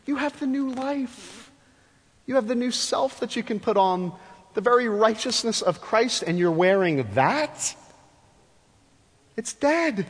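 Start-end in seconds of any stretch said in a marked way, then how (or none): 0.0-7.8 incredulous Paul. Retold or imitated. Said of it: "he's going".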